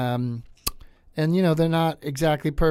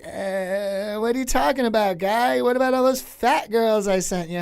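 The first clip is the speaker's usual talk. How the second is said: much higher put-on Norm voice; raised, wry Norm Macdonald voice; Norm Macdonald impression; 10 semitones higher than usual. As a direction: needle him, Norm-style. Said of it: "he answers in the voice of Norm Macdonald".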